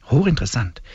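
ordinary talk, nothing marked

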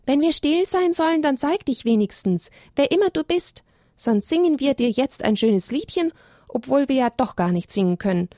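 high, cheeky shout into the tree; cheeky lamb daring the voice; cheeky call. A narrator's reading. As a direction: call out boldly, teasing. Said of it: "rief Elia ganz frech".

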